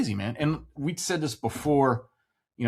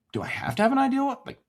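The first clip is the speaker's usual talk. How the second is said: surprised realization; 12 semitones higher, faster than usual. Retold or imitated. Realizing he needs no plan.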